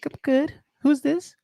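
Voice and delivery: very high, soft phone voice; put-on girl voice. Streamer asking a caller who it is, put-on girl voice. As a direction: pitch it way up, softly, like a girl.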